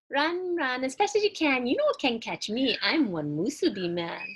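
high, loud sing-song taunt in pidgin; a gleeful little runaway rice ball; cheeky Musubi man. Acted. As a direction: tease them from far ahead, chanting. Musubi man taunts his chasers.

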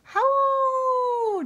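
loud, high, long-held howl; wolf howl. A narrator's reading. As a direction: throw back a full-voiced "howl" like a wolf. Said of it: "howled as loudly as he".

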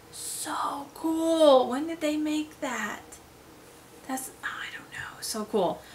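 breathy whisper of amazement; hushed wonder; awed whisper. Real, not acted. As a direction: whisper it, stunned by the art. Whispered awe at art.

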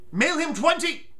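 normal talking voice

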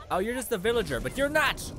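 furious scolding of the enemy; angry yell. Real, not acted. Yelling at enemy attacking pet.

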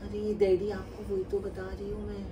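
put-on soft girly voice, fan calling; a man playing a shy girl; man doing a woman's voice. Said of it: "मैं ना आयशा बोल रही हूँ".

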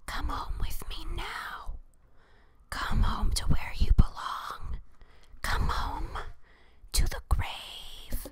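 slow, dry ghostly whisper to lure them home. A narrator's reading. A ghost summoning kids home to the grave, whispered. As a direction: breathe it like a ghost calling from the grave. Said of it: "dry, whispered voice".

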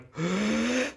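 high sharp intake of breath, holding it; sharp held-in gasp. Retold or imitated. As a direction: gasp in and hold the breath tight. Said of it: "they go".

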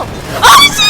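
high screaming into laughter; terrified scream. Real, not acted. Caught again by the monster.

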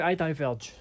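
usual speaking voice

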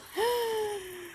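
high startled intake of breath; sharp gasp. Real, not acted. Player startled mid-game, sharp gasp. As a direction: gasp in sudden fright.

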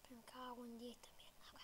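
whispered curse under the breath; cursing under breath. Real, not acted. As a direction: hiss the swear quietly to yourself. Fluch während Horrorspiel.

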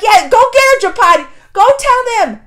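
high, loud cheer for Draupadi; root for her at full voice. Retold or imitated.

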